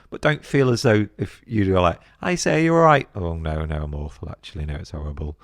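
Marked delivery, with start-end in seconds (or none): 2.2-3.1 much higher, sing-song "you all right?"; chirpy British greeting. Retold or imitated. 3.2-5.4 lower, softer, sinking moan; mock gloomy overshare. Acted gloomy overshare reply.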